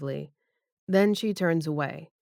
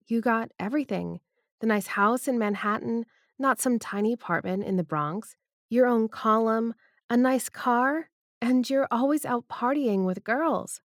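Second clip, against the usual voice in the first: lightly raised female voice; Debbie's voice; 6 semitones higher than usual. Acted. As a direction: voice the woman, a bit higher. Debbie listing his privileges.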